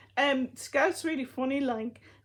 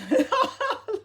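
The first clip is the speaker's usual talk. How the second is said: a high, loud laugh; real laugh at the dog's antics; burst of laughter; 11 semitones higher, 6 dB louder than usual. Real, not acted. Laughing at the robot dog.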